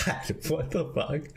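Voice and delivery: ordinary delivery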